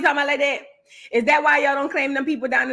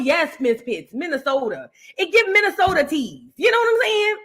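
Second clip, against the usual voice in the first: ordinary talk, nothing marked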